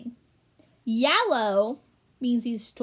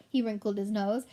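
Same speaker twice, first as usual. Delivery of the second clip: ordinary delivery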